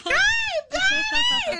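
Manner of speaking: very high, loud, gushing call; jolly mom impression. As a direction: imitate a mom squealing hello to her darling. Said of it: "And she's just like".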